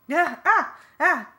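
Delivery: high, loud, panicked cries; sharp bursts of yelling; startled yell. A narrator's reading. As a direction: yelp in alarm. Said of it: "he yelled".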